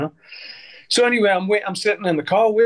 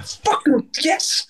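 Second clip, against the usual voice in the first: retold shocked recognition; 12 semitones higher, much slower than usual. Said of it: "I was like".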